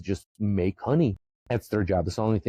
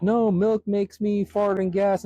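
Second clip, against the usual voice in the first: much higher, slow, whiny mock protest; mocking whiny complainer; 11 semitones higher, 3 dB louder, slower than usual. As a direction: whine it like a fussy milk-hater. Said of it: "And if you're like".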